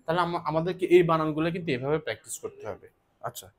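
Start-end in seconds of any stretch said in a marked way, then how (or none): none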